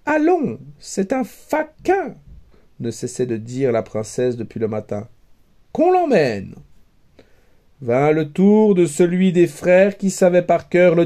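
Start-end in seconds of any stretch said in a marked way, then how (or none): 0.1-2.1 high, scornful princess voice; a royal sneer at the suitor; haughty princess. A narrator's reading. Princess dismissing a foolish suitor, high and scornful. 5.7-6.5 high, curt royal order; haughty princess. A narrator's reading. Princess ordering the suitor taken away, high and curt.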